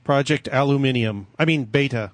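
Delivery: "Beta" said American, "bayta"; American accent on Beta; American pronunciation. Shown as a demonstration. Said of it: "[name] can say it properly American way".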